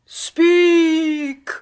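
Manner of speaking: loud summons to the spirit; commanding call. Acted. Medium summoning the spirit at the séance, commanding call. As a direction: call out to the beyond.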